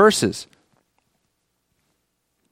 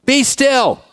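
hollered out; 6 semitones higher than usual. Retold or imitated. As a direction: call it out over the open ocean. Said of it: "I just hollered out the ocean".

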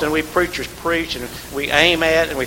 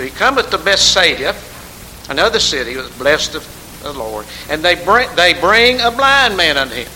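louder scripture telling; fervent preaching; 6 dB louder than usual. Acted. Preaching at full force.

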